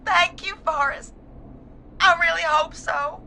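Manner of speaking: high, grieving thanks; tearful. Acted. Bereaved caller thanks host for comfort, tearful. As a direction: thank him through the grief.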